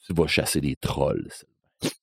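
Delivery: low, fading whisper of the dark man; a low menacing hush; eerie stranger. Retold or imitated. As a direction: breathe the name like a ghost. Stranger's eerie final line.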